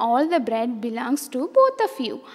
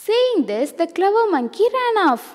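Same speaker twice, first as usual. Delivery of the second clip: usual speaking voice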